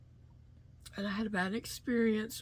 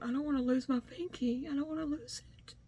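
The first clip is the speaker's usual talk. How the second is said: teary plea; 7 semitones higher, faster than usual. Retold or imitated. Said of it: "I did get a little teary-eyed".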